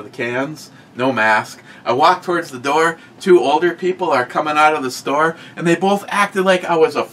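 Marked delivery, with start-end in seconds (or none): 5.5-7.0 higher, disbelieving story voice; incredulous. Real, not acted. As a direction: tell it in rising disbelief. Mocking people jumping back.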